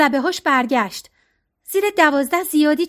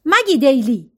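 sharp surprised call of a name, louder; calling out sharply; 4 dB louder, slower than usual. A narrator's reading. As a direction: shout her name from the doorway, caught off guard. Serena bursts in on them.

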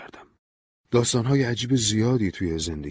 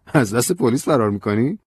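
playful, raised teasing question; teasing joke; 6 semitones higher, 7 dB louder than usual. A narrator's reading. Narrator teasing Ben as he drags her along, playful. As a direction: tease him lightly about running from police. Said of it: "به شوخی گفتم".

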